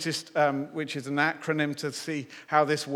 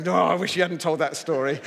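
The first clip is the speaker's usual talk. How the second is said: louder mock groan of complaint; grumbling men; 2 semitones higher, 7 dB louder than usual. Preacher imagines the men in the room reacting, mock grumbling. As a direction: imitate husbands grumbling at being shown up. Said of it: "all the men are going".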